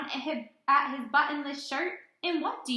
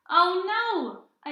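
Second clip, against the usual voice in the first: higher, louder playful oh no; mock alarm; 7 semitones higher, 5 dB louder than usual. A narrator's reading. Teacher reading aloud, playful loud higher dismay. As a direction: act out mock dismay for the kids. Button lost in the story.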